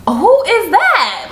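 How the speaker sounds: high, slow startled call at the door; startled who is that. Retold or imitated. Woman hearing a knock late at night, startled high call.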